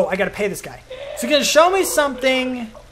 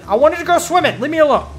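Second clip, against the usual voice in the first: mock defensive; 6 semitones higher, 6 dB louder, faster than usual. Real, not acted. Defends himself after dumb jump.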